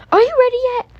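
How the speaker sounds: high, loud call to hurry; impatient call. Real, not acted. Mom calling the kids to hurry up. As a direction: call out to rush them.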